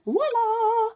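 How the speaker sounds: high, showy reveal; playful voila. Real, not acted. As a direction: pull it out like a magic trick. Revealing pre-cut butterflies.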